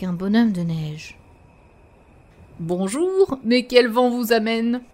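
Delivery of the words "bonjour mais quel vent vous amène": snowman voice. Acted. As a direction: greet like a jolly snowman. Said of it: "fit le bonhomme".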